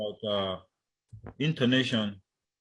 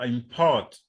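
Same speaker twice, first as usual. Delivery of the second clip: import drawn out as im-PORT; stress on second syllable; 4 dB louder than usual. Shown as a demonstration. Teacher giving the verb, import stressed on PORT. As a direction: lean slowly on PORT in import. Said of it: "that's the verb".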